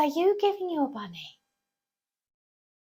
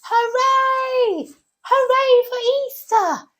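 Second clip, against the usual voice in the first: high, loud shout of joy; bunny cheering Hooray for Easter, much higher and louder; 12 semitones higher, 7 dB louder, slower than usual. Acted. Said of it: "this one's got his arms up going".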